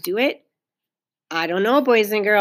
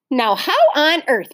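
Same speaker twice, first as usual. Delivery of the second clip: louder, higher, baffled wondering; puzzled; 5 semitones higher, 6 dB louder than usual. A narrator's reading. Mother wonders aloud about the door, puzzled. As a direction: puzzle it out with emphasis. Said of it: "in a puzzled voice".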